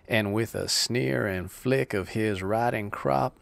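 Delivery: normal talking voice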